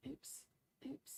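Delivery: ordinary talk, nothing marked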